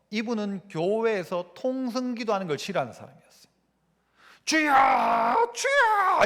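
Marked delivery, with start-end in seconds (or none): 4.4-6.3 길게 늘인 "주여", 높고 크게 외치는 "주여!"; 부르짖는 기도처럼 외치기; 통성기도 외침. Retold or imitated. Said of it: "무슨 소리 질러가면서 기도하느냐". (통성기도하듯 부르짖는 외침)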